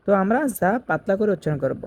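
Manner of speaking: ordinary talk, nothing marked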